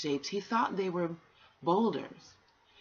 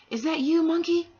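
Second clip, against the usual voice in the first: much higher and louder, a small creature calling out; high tortoise voice; 12 semitones higher, 9 dB louder than usual. Acted. Tortoise asking the monkey in the dark, high and loud. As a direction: pipe it up high like a hidden tortoise.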